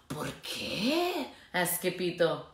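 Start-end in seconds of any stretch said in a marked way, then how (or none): 0.1-1.3 timid whisper; whispered question. Acted. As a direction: ask it under breath. Skipito asks in fear.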